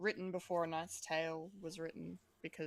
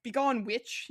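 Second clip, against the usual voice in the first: louder, lordly posh command; 4 semitones higher, 9 dB louder, slower than usual. Retold or imitated. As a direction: dismiss her like a haughty lord. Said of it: "like, in this posh voice".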